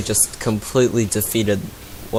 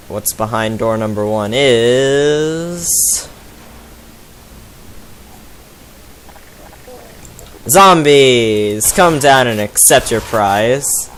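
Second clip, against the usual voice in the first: game show host; slower than usual. Pretend. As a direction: announce the prize like a host. Game show reveal of the door.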